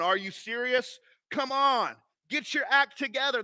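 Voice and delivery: mock scolding God. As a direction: wag a finger at the sinner. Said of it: "pointing his finger, going".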